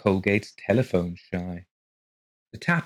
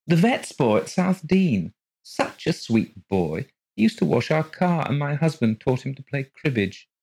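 odd woman voice; 7 semitones higher, 3 dB louder than usual. Acted. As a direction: play her vague and a little off. Mrs Colgate reminiscing.

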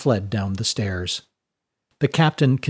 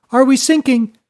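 much higher, loud panicked question; terrified call; 12 semitones higher, 7 dB louder than usual. A narrator's reading. Terrified passenger calling out to the mate, high and loud. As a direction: cry out in alarm. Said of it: "called out a terrified passenger".

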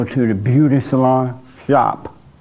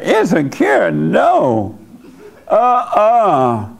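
higher-pitched, offended echo then refusal; mock indignation; 5 semitones higher, much slower than usual. Old woman rejecting the man's idea about women, mock indignant. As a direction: imitate her scandalised denial.

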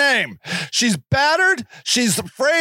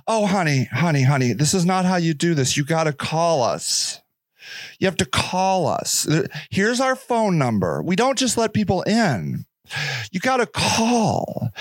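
condescending shelter worker; 5 semitones lower than usual. Retold or imitated. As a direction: talk down to her like a bored clerk. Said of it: "shelter worker voice".

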